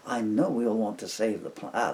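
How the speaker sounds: usual speaking voice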